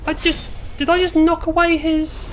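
high, loud startled outburst; real shocked disbelief. Real, not acted. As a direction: cry out in astonishment, pitch leaping up. Startled by odd game event.

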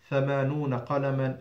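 usual speaking voice

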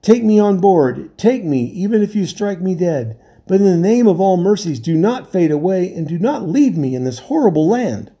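high, frantic begging; terrified man begging not to be left; desperate pleading. A narrator's reading. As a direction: clutch at them with every word. Castaway begs to be taken.

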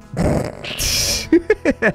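a high, airy burst of laughter; breathy giggle. Real, not acted. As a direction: giggle under your breath. Laughs at forgotten colonist.

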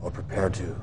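cold whispered warning; quiet threat. Acted. Threat closing the briefing.

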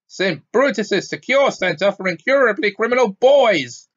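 high, loud exasperated bellow. A narrator's reading. Uncle correcting the boy on the school name, bellowing. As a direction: bellow the whole name, building on the last word. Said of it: "bellowed Uncle Vernon".

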